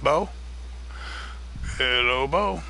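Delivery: ordinary talk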